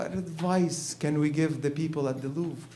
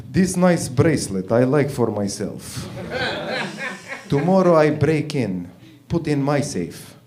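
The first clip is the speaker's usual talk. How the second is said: slow, laughing Eastern European thief voice, louder; Eastern European accent; 2 semitones higher, 6 dB louder than usual. Retold or imitated. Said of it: "Igor walks in the Louvre".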